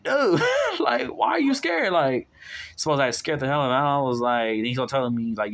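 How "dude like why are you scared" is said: way up high with a laugh in it; incredulous laugh. Retold or imitated. Telling viewers how the bigger man acted scared, high and laughing. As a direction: squeak it out, amused he's scared.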